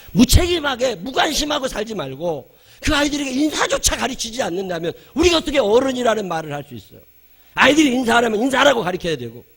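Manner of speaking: high-pitched, forceful exhortation; impassioned preaching. Real, not acted. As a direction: urge the congregation at full force. Exhorts congregation at full force. (높은 목소리로 힘주어 외치는 설교)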